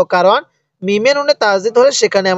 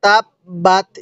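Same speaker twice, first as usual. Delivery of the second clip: slow, measured tajweed chant with long held vowels; Quran recitation; much slower than usual. Shown as a demonstration. Teacher reciting the sura for learners to hear, slow tajweed chant. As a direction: recite slowly, stretching vowels and nasal sounds. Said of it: "একবার revision দিব".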